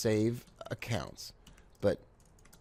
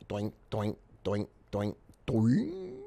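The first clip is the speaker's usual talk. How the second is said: doink sound effect; slower than usual. Pretend. Sound effect for price bouncing.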